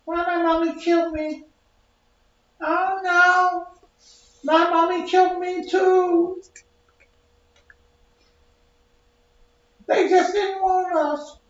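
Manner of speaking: put-on very high, slow whiny baby voice, crying; dead baby voice. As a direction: squeak it out like a sobbing ghost baby. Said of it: "That's what your baby be saying".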